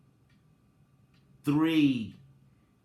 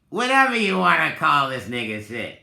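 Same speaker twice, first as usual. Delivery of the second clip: fast and much louder, fired up; excited outburst; 4 semitones higher, 8 dB louder, faster than usual. Real, not acted. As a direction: blurt it out, swept up in the idea. Animated riff on his method.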